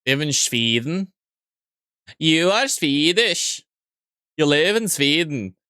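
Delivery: badly faked Swedish accent, slow and drawn out; terrible Swedish accent. Streamer teasing a viewer from Sweden, terrible mock Swedish accent. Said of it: "That was a terrible Swedish accent".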